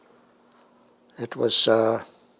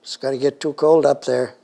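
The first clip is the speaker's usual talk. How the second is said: mock yell of a dad calling up the stairs, louder; retold yell; 3 semitones higher, 5 dB louder than usual. Dad calling upstairs through the register to the kids, mild retold yell. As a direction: call up to the kids, half-hearted shout. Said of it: "he'd yell up and say".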